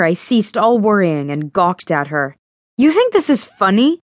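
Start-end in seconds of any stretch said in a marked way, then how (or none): 2.8-4.0 indignant. Acted. Friend laughing at her.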